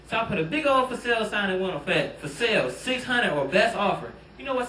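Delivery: ordinary talk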